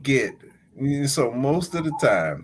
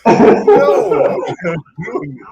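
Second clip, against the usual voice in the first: laughing loudly and high, cracking up; 9 dB louder, much slower than usual. Real, not acted. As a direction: lose it laughing in disbelief. Laughing at the absurd fee.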